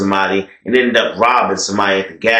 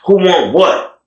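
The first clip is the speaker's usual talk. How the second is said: taunting challenge; 6 semitones higher, 3 dB louder, slower than usual. Retold or imitated. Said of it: "he talking to the Bloods".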